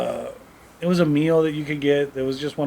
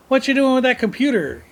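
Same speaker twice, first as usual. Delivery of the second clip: higher-pitched neighbor calling over; friendly neighbor; 9 semitones higher than usual. Retold or imitated. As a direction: imitate a neighbor asking a kid. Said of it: "the neighbor looks over and goes".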